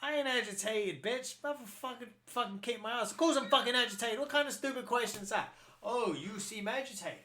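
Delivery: ranting back at the Emperor in mock fury; mock furious Vader. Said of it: "And he's like".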